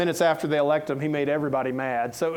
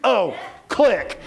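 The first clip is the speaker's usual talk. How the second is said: huffy caller hanging up; 5 semitones higher, 6 dB louder than usual. Retold or imitated. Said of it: "And she hung up".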